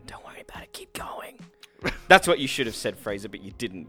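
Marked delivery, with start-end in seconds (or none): 0.1-1.4 breathy unvoiced whisper; sexy whisper. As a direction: whisper it seductively. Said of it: "really sexy when they just go".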